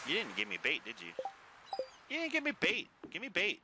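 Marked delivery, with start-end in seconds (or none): 2.1-3.6 high, whiny demand; squeaky whine. Real, not acted. High, demanding complaint at game.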